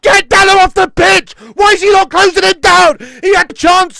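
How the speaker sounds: yelling at the screen at his own player; loud, angry, high bellow; furious shout. Real, not acted. Fan screaming at his team's defender, furious shout.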